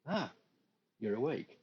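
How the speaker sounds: brisk, practical nurse greeting; matter-of-fact nurse. Acted. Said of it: "very matter-of-fact manner that only nurses use".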